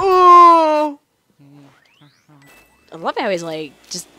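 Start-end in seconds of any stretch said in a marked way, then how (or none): 0.0-1.0 loud, high drawn-out ooh; sudden high cry of "ooh". Real, not acted. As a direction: let out a big, high "ooh". Outburst during play.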